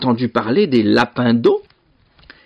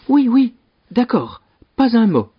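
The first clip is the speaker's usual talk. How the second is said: high-voiced brother agreeing readily; high, compliant assurance; eager promise; 12 semitones higher than usual. A narrator's reading. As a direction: promise it hastily in a high character voice. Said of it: "promit Stéphane".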